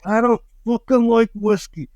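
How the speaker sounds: high, put-on whiny protest; mock disgusted refusal; retold protest. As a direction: whine it like a cornered guy. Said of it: "So I was like".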